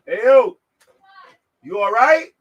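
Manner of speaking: hey, you all right, calling out. Real, not acted. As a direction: call out with concern to a stranger. Calling out to someone outside.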